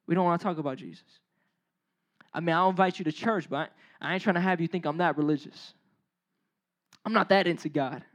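low, hushed, evasive excuse. Acted. Believer avoiding talk of Jesus with friends, low and hushed. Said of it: "we keep it on the hush".

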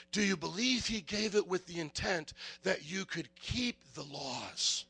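ordinary talk, nothing marked

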